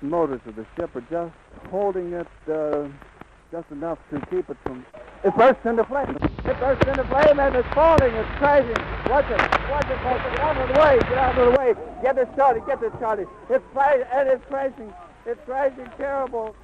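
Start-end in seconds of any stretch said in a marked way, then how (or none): 5.2-16.6 horrified reporter screaming at the crash; frantic shouting. Real, not acted. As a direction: yell it out as disaster unfolds. Hindenburg bursting into flames live.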